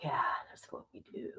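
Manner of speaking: breathy fast whisper aside. Real, not acted. Telling the viewers a secret in a quick whisper.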